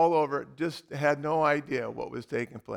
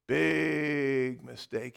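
slow, lower, foreboding; ominous; 4 semitones lower, 4 dB quieter, much slower than usual. A narrator's reading. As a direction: drag it out as a warning. Ominous storytelling beat.

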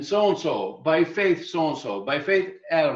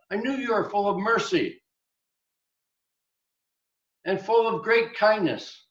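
higher, exasperated Jonah grumbling at God; a hint of a sulking prophet, pitched up; Jonah complaining; 6 semitones higher than usual.

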